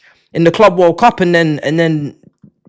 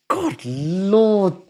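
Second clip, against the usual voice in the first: God Lord lifted higher, still soft, exasperated; 3 semitones higher, 6 dB quieter than usual. Real, not acted. Streamer repeats God Lord higher, softly exasperated. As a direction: lift God Lord up in disbelief.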